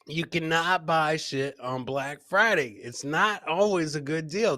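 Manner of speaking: normal talking voice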